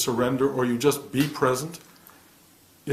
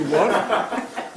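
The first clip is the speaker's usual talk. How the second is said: surprised what; 7 semitones higher, 4 dB louder than usual. Retold or imitated. Reacting to a schmuck from beyond.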